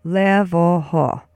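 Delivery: "Levoho" stretched slowly, LEH-vo-ho; slow syllable demo. Shown as a demonstration. Teacher has listeners join in, "Levoho" slow as LEH-vo-ho. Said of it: "Say it with me once more".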